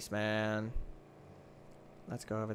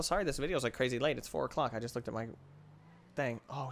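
normal talking voice

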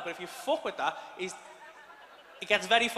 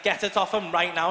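stern wife; 7 dB louder than usual. Retold or imitated. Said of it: "She's like".

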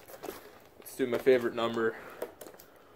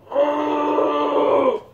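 sharp high yelp of pain; startled yelp as water burns; pained cry; 13 semitones higher, 4 dB louder than usual. Real, not acted. Chokes on water up nose.

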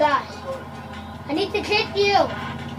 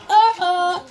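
ordinary delivery

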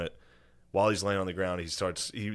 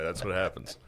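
usual speaking voice

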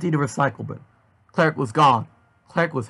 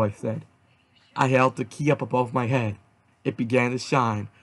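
ordinary delivery

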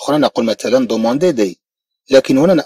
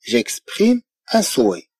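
very slow, softer French; slow model reading; 5 dB quieter, much slower than usual. Shown as a demonstration. French teacher reading to students, slowly. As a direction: spell it out slowly for learners. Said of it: "في اللغة الفرنسية نقول".

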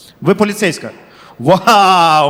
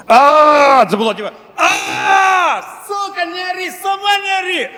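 high, shrill-free screaming fight; hammed-up screaming quarrel; mock screaming row; 11 semitones higher, slower than usual. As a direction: yell back and forth like two friends bickering. Acted shouting match between friends.

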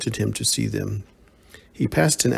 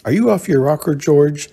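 higher and louder, full of suspicion; a suspicious girlfriend questioning George; 5 semitones higher, 5 dB louder than usual. Acted. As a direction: eye him warily as you ask. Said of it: "she asked suspiciously".

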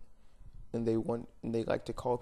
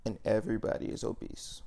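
ordinary delivery

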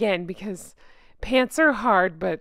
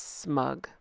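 smug caption; 10 semitones lower, 11 dB quieter than usual. Pretend. One-word smug caption.

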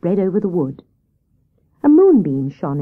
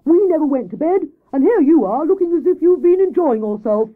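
high, loud, exasperated Hare; indignant scolding; 9 semitones higher, 5 dB louder than usual. Acted. Hare scolds Grey Rabbit on her return, indignant. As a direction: scold loudly, lifting the pitch.

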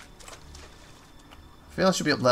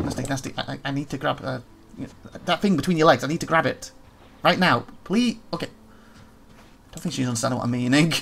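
ordinary delivery

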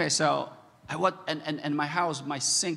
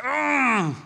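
loud, high exasperated groan; mock roar of frustration; frustrated groan; 10 semitones higher, 7 dB louder than usual. Dad mad at himself over the desk, frustrated groan.

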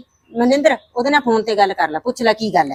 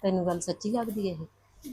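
lower, much softer, slow worried question; hushed worry; 5 semitones lower, 18 dB quieter, slower than usual. Acted. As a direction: ask it under your breath. Worried mother asks daughter privately. (ਹੌਲੀ ਫ਼ਿਕਰਮੰਦ ਆਵਾਜ਼)

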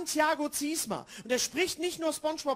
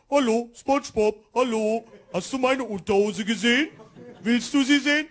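Patrick impression; 3 semitones lower, 5 dB louder than usual. Starfish Patrick greeting SpongeBob, lightly voiced. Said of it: "Der Patrick kommt immer an".

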